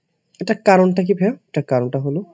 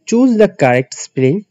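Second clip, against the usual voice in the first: slow, careful reading of the instruction; slow reading aloud; 5 semitones higher, much slower than usual. Shown as a demonstration. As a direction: spell out the question slowly for the class. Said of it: "দুই নম্বর প্রশ্নে বলা আছে". (ধীরে প্রশ্ন পড়া)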